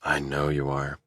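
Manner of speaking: plain reading voice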